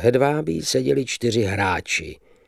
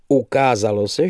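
ordinary delivery, nothing marked

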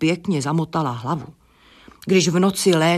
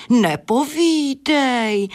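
slow, higher, breathed in wonder; a hen's hushed, admiring gasp; 8 semitones higher, much slower than usual. Acted. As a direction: marvel at it, slowly and high. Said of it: "vydechla Adéla obdivně".

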